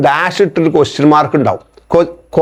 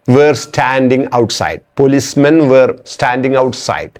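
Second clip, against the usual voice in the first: slow dictation; 3 semitones lower, much slower than usual. Shown as a demonstration. Teacher dictates example slowly.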